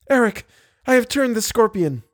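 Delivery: high, urgent call; crying out. A narrator's reading. Christine tells Eric of the scorpion, crying out.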